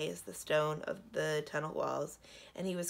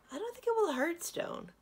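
high-pitched, brightening realisation; almost cheerful; 14 semitones higher than usual. A narrator's reading. Stone prince shrugs off being eaten by dragons, almost cheerful. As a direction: lift it up high, nearly cheery. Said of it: "He sounded almost cheerful".

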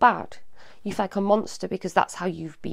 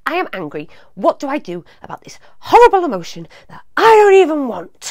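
a loud, higher, fast outburst of her younger self; younger self angry; 5 semitones higher, 10 dB louder than usual. Retold or imitated. Recalling her younger self, yelling it out in frustration. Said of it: "So I used to say when I was younger".

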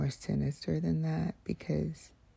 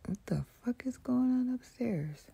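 irritated whisper; 5 semitones higher, 4 dB quieter than usual. Real, not acted. Annoyed at upstairs noise.

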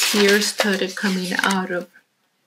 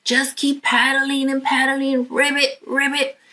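encouraging frog; 6 semitones higher than usual. Acted. Freddie in the front goes.

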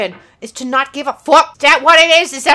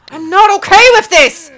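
high, loud outburst; loud protest; 8 semitones higher, 7 dB louder than usual. Real, not acted. Gamer admitting they're not coping, loud protest. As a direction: wail it out in protest.

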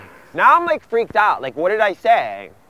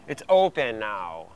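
ordinary talk

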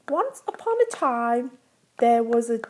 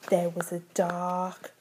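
hushed spooky reading; 7 semitones lower, 8 dB quieter, slower than usual. Acted.

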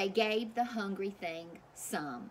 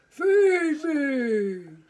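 much louder booming beast roar; roaring monster demand; 6 semitones higher, 12 dB louder than usual. Acted. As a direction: bellow it like a ravenous monster. Hungry monster points to sign.

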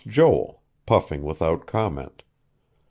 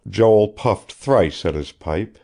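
ordinary delivery

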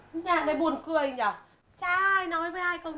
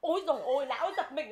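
ordinary talk, nothing marked

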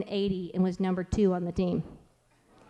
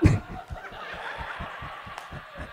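laugh; 8 dB quieter than usual. Real, not acted. Laughing at own story.

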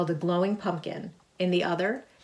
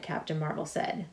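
ordinary delivery

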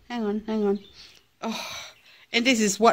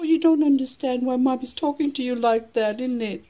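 ordinary talk

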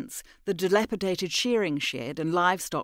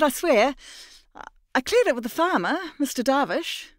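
higher, fast, stumbling protest; nervous bluffing; 8 semitones higher, 4 dB louder than usual. Acted. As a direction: trip over the words as you lie. Mira lies nervously.

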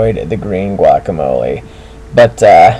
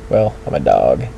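ordinary talk

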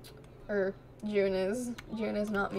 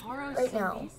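usual speaking voice

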